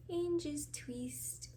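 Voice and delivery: slow, breathy whisper, drawn out. Real, not acted. Vlogger whispers the braid step to viewers, slow whisper. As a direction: whisper it slowly as you twist. Whispered repeat of twisting instruction.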